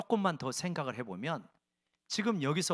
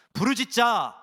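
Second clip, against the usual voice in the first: higher, louder call to cry out; rallying call; 4 semitones higher, 8 dB louder than usual. Real, not acted. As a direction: lead the crowd in a shout. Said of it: "한번 따라해봅시다".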